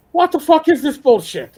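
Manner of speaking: shouted Irish brogue, high and loud. Retold or imitated. Old Irish CO swearing down the phone, loud Irish brogue. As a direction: bark it in a thick Irish accent. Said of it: "this Irish brogue".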